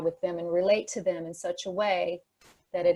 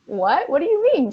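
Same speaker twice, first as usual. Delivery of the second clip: confused what; 8 semitones higher, 8 dB louder than usual. Retold or imitated. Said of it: "I was like".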